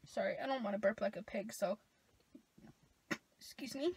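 speaking into sleeve; muffled behind forearm; mouth covered by sleeve. Real, not acted. Seen 4: Forearm sleeve pressed over mouth throughout the words, stifling a burp.

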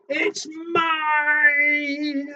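high, drawn-out loud claim; squabbling cry. Acted. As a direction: wail it like a child in a fight. Both animals fighting over chair.

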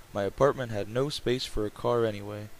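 ordinary delivery

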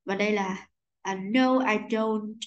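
ordinary talk, nothing marked

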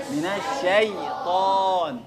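الشيطان with a slow, drawn-out sha; clear sha sound. Shown as a demonstration. Teacher drilling students on the sha, slow and drawn out. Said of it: "الشا دايمًا شا في القرآن كله".

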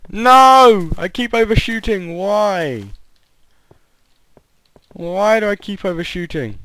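loud cry, then high whining questions; frustrated yell into a complaint. Real, not acted. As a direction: cry out, then whine at yourself. Falls again, complains.

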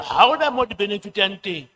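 ordinary delivery

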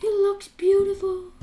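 hushed awe. Pretend. Player admiring glowing dark armor, soft hushed awe. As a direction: soften into awed admiration.

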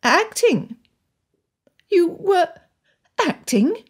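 high, halting astonishment; stunned, high-pitched questioning; head-spinning disbelief. A narrator's reading. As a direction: falter over it, breath gone in surprise. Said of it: "Anne's head spun".

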